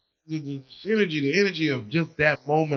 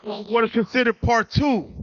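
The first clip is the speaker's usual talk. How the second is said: show intro; 4 semitones higher than usual. Acted. Host opening the show.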